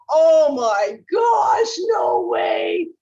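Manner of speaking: high, loud, stretched-out gasp of disbelief; slow and loud, like you can't believe what you just heard; excited shout. Retold or imitated. Assistants overhearing a phone call, shocked excited shout. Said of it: "my assistants outside the room go".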